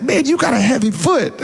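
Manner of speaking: high-pitched voiced compliment; imitated excited praise. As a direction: put on a high, impressed voice.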